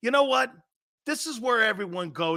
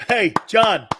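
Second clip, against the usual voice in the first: loud call of John's name; loud mock-stern call to John; 3 semitones higher, 9 dB louder than usual. Real, not acted. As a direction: shout his name across the room. Calling out to donor John.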